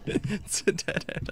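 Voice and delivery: loud frightened cry; panicked shout. Real, not acted. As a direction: yell at the dead end. Panic at dead end.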